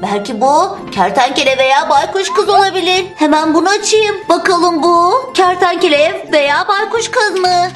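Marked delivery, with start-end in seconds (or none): none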